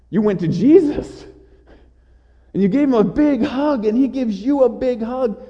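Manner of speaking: high-pitched, elated preaching; joyful excitement. Acted. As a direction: tell it as an overjoyed reunion, voice lifted high. Joyful climax of the Lazarus reunion.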